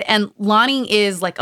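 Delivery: normal talking voice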